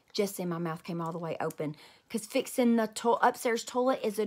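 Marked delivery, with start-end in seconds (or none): none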